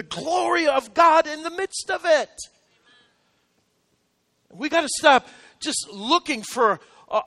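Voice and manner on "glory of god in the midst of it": preaching shout. Real, not acted. Preacher climaxes on God's glory.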